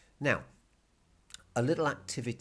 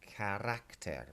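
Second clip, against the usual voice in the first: "carácter" said slowly, RAC stressed; stress on second A; 4 dB quieter, slower than usual. Shown as a demonstration. Teacher repeating for students, "carácter" stressed on RAC. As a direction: draw out "carácter", leaning on the middle RAC. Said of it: "hitting the second A as you say it".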